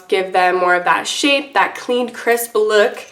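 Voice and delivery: ordinary delivery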